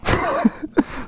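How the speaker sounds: breathy giggle; a quick airy chuckle; high unvoiced laugh. Real, not acted. Laughs about the time lapse.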